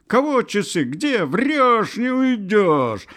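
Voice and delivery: high, loud woman's wail; high, fierce shrieking; terrible howl. A narrator's reading. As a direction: howl it at a thief. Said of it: "она взвыла страшным голосом".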